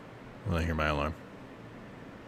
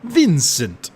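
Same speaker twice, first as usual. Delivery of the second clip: louder, higher, slow brooding Vincent read; 8 semitones higher, 5 dB louder than usual. Acted. Streamer voicing Vincent from his coffin, louder brooding delivery. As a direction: intone it darkly like a cursed gunman. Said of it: "this is the perfect accent".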